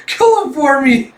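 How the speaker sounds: very high, loud, drawn-out plea; hammed-up desperate cry; mock screaming panic. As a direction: wail it in pretend terror. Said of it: "you hear him just screaming".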